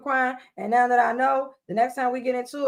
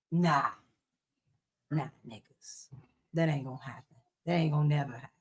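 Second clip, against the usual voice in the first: much lower, softer, dismissive man's refusal; deep mock man voice; 8 semitones lower, 10 dB quieter than usual. Mocking the man who refuses to be checked, deep dismissive voice. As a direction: drop way down and brush it off like the boyfriend.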